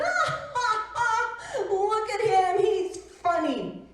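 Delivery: high, loud jeering laughter; mocking laugh. Bunny laughing at the Velveteen Rabbit, high and jeering. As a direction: laugh at him to hurt. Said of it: "he laughed at the Velveteen Rabbit".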